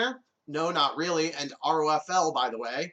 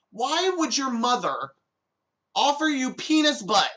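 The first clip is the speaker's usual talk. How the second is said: higher and louder, building to an outraged cry; mock indignant, rising in disbelief; indignant shout at the absurd offer; 8 semitones higher, 5 dB louder than usual. Outraged reply to silly text.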